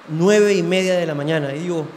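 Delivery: ordinary talk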